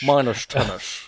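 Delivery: slow "Minus ten-ish" with a slushy Connery "sh"; a slurred Scottish Connery drawl on "Minus ten-ish"; teasing a friend with a Connery accent; mock Sean Connery. Mocking the Connery sound.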